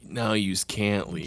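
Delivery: slow, drawn-out mobster line; movie mobster quote. Retold or imitated. Quoting a mob film to the co-host, slow menace. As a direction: lightly imitate a gangster, low and unhurried. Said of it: "Was that Casino or Goodfellas".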